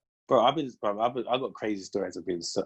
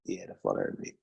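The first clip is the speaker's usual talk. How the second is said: low breathy whisper under his breath; whispered to himself; 9 dB quieter than usual. Retold or imitated. Driver noticing the police car behind him, whispered. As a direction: mutter it like you don't want them to hear.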